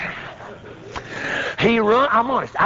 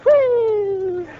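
high, long "whoo"; imitated whoop. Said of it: "that feller that went".

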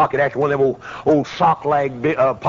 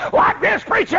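high-pitched frantic yelp; scared policeman. Retold or imitated. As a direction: cry it out high, acting terrified. Acting the scared policeman at door.

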